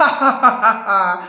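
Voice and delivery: slow acted villain's laugh; mocking laugh. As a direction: laugh out a drawn-out ha-ha-ha. Henchman laughing at his own report.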